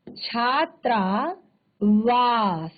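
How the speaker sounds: छात्रावास drawn out slowly, vowels merged into one long आ; slow blended demo. Shown as a demonstration. Teacher slowly demonstrating the joined word to students. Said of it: "बिल्कुल साथ में उच्चारण हो रहा है".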